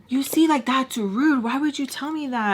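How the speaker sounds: put-on whine; whining. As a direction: imitate a hurt boyfriend whining. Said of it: "he's whining, like, whining".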